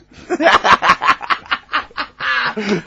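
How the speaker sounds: loud, high cackling laugh; helpless laughter. Real, not acted. Co-host cracks up at the [name] story, loud high laughter. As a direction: cackle loudly, high and long.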